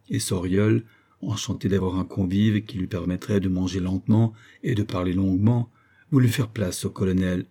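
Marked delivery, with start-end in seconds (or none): none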